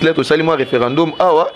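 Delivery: ordinary talk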